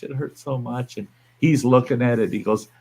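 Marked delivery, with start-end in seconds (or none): 0.0-1.0 much softer whimpering complaint; wife's moan trailing off softly; mock whining. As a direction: fade the moan down to almost nothing. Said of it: "she's whining and bitching".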